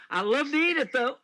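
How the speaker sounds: laughing. Real, not acted. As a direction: let the laugh carry the line. Laughing about seafood tastes.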